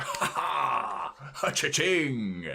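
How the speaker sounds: drawn-out mock laugh into a slow cha-ching; gloating rival. Adidas cashing in on Nike's failure, hammed-up gloating. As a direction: gloat theatrically, stretching the last word out.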